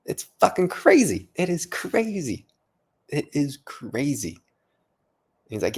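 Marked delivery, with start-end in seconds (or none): 0.1-4.4 high-pitched, emphatic astonishment; amazed disbelief. Real, not acted. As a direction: draw out the key word in real amazement. Marvelling at hidden wealth.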